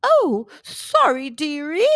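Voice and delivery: a huge kindly woman apologising; giant woman. A narrator's reading. Giant woman apologises to drenched Jack, slow and kindly. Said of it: "said the giant woman".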